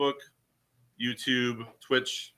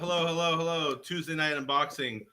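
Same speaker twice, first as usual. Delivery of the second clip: live show opener building to a drawn-out name; show announcer; 4 semitones higher, 5 dB louder, slower than usual. Acted. Co-host opening a live unboxing show, announcer style.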